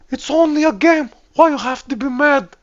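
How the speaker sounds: very high, loud put-on voice; quoted meme voice. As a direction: recite it as a squeaky high-pitched quote. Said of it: "If you know the quote".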